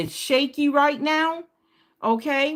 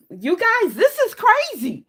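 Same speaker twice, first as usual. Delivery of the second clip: excited; 11 semitones higher than usual. Real, not acted. Tarot reader thrilled telling viewers about the repeat card. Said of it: "I get excited".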